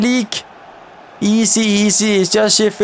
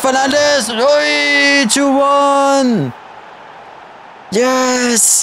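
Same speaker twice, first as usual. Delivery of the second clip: goal celebration; 6 semitones higher, much slower than usual. Real, not acted. Gamer celebrates taking the lead, excited shout. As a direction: cheer the go-ahead goal.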